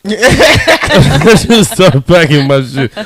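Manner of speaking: slow, loud, laughing through it; stretched out between laughs. Real, not acted. Repeating his own punchline, laughing.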